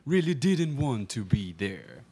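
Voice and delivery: ordinary delivery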